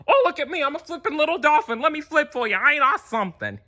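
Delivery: high, quick sneering dolphin impression; mock a smug dolphin. Mocking dolphins who think they're so cute. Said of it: "they think they're so cute".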